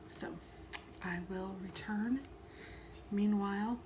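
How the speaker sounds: ordinary delivery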